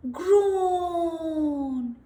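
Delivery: long drawn-out groan of pain; crocodile groan. Acted. Crocodile with a swollen jaw groaning in pain. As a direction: moan like a hurting crocodile. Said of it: "he was crying real tears".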